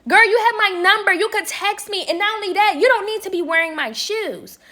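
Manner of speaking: fast, high exasperated scold; heated scolding. Retold or imitated. Scolding roommate in heated argument.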